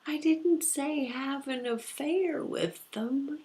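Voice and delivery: high, loud, drawn-out mock protest; exasperated retort. Reader answering the client's objection, high exasperated retort. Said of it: "And I'm like".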